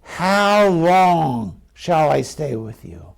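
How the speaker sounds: slow, drawn-out exasperation; worn-out patience, words stretched slowly; frustrated. Acted. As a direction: drag out the question in frustration. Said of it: "Here's the frustration".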